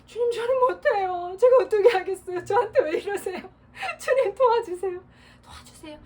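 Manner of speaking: high, loud, crying plea to the Lord; tearful prayer. Pretend. Preacher demonstrating a desperate prayer to the congregation, tearful and pleading. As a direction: beg God for help through tears, half laughing. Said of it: "기도하는 거예요".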